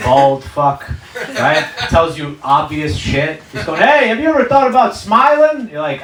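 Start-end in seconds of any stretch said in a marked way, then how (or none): 3.8-5.7 mock-cheery therapist, high and loud; Doctor Phil. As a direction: pipe up with glib, obvious advice. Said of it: "some, like, Doctor Phil".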